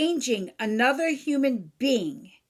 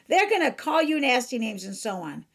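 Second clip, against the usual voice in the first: usual speaking voice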